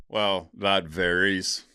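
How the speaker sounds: slow, musing drawl of that varies; slow drawn-out. Acted. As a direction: stretch out "Well, that varies" thoughtfully and slowly. Answering a viewer question.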